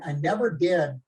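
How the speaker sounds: ordinary talk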